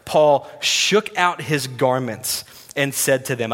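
ordinary delivery

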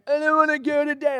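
whining child imitation. Child woken for Sea Cadets, acted whine. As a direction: whine like a kid who won't get up.

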